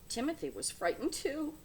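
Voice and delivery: ordinary delivery, nothing marked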